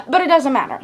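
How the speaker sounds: ordinary delivery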